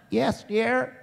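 unruffled, put-on high wifely voice; wife's sweet reply. Mother's mild reply to his holler.

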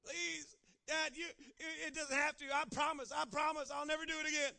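put-on high, loud pleading child; child begging his daddy, loud and high. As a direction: whine and beg like a small kid.

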